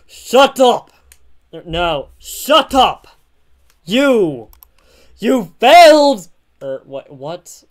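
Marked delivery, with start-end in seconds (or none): 0.0-3.1 loud acted outburst; angry shout. Retakes of hero's shouted line. 3.8-6.3 loud, high theatrical yell; accusing shout. Acted. Hero's shouted line.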